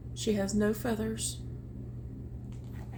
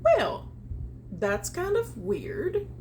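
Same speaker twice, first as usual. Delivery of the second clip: puzzled; 7 semitones higher, slower than usual. Acted. Characters notice strange change.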